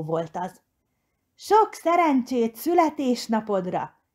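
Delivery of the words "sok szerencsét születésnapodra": called out. A narrator's reading. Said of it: "kiáltotta Mici Mackó".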